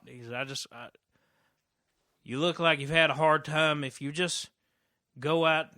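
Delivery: loud, uneasy advice from a stranger; loud nervous street guy voice; scraggly stranger. Acted. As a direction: push him toward help, keeping distance.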